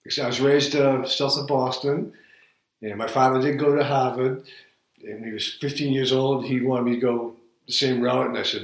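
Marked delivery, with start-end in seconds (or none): none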